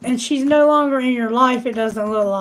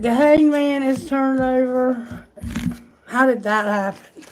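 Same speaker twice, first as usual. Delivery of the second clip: normal talking voice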